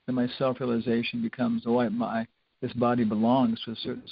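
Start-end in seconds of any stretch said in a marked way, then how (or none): none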